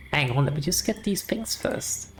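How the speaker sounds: ordinary talk, nothing marked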